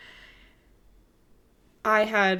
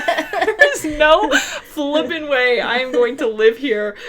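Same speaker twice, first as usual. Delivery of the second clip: louder, slow, laughing through it; 4 semitones higher, 8 dB louder, slower than usual. Real, not acted. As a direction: laugh it out slowly. Laughing at own despair.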